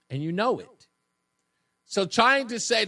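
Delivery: ordinary delivery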